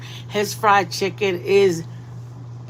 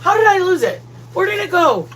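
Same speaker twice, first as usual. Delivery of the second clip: mocking husband; 6 semitones higher, 4 dB louder than usual. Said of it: "He's like".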